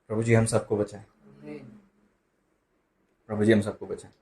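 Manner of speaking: low, very soft plea to God; hushed prayer. Real, not acted. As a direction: drop right down into a quiet prayer. Murmured prayer after grim warning. (धीमी, दबी आवाज़ में प्रार्थना)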